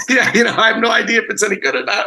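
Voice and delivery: high, loud, fast through laughter; laughing. Real, not acted. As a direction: giggle it out nervously. Laughing at own awkward promo.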